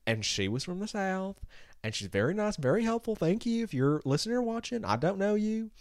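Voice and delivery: high, soft Southern lilt; Southern accent. Retold or imitated. Describing the helpful agent from the South, Southern accent.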